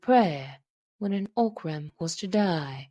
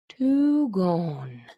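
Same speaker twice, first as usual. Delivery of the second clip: strangely lowered voice; 3 dB louder than usual. A narrator's reading. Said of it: "his voice lowering strangely".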